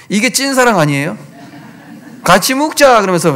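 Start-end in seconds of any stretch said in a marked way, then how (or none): 2.2-3.0 꼬드기는 하와. Pretend. 하와가 아담을 꼬드기는 장면.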